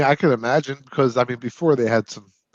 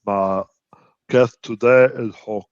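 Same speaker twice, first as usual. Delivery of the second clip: Andre the Giant voice. Pretend. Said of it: "You sound like Arnold Schwarzenegger trying to do Andre the Giant".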